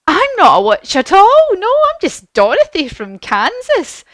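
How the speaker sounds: high, loud startled protest; emphatic, high-pitched denial; Dorothy. Acted. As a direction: protest innocence in a high girlish voice. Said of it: "And Dorothy says".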